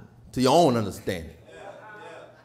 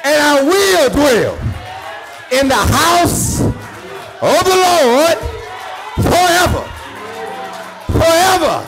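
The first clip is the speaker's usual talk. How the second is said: high, loud whooping preacher cadence; sing-shout each line up to the congregation; preaching at full force; 12 semitones higher, 8 dB louder than usual. Real, not acted.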